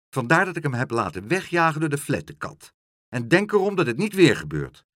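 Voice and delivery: stern motherly telling-off; scolding mother. A narrator's reading. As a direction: warn the child firmly. Mother warns daughter firmly.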